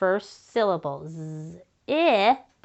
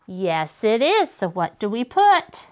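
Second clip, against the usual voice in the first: ordinary talk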